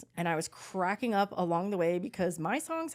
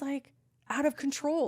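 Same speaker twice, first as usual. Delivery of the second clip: usual speaking voice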